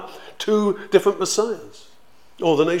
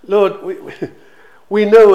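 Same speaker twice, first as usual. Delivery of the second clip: louder and higher, laughing as they blurt it; eager outburst; 6 semitones higher, 12 dB louder than usual. Retold or imitated. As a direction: burst out in delighted certainty. Disciples eagerly blurting to the Lord.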